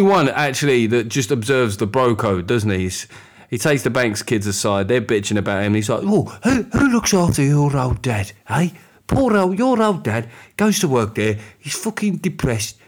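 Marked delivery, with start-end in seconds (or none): 6.0-12.8 mock cockney chimney sweep, hammed up and high; a bad imitation of Bert's cockney, pitched up. Bert consoling the Banks kids, in mock cockney. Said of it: "he's gone brummy now".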